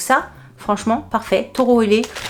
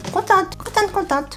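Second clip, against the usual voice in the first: happy squeal; 11 semitones higher than usual. Real, not acted. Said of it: "répète sa joie".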